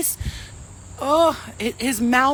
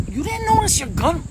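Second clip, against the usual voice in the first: incredulous question; 8 semitones higher, 4 dB louder than usual. Retold or imitated. Flo confronting the bouncer.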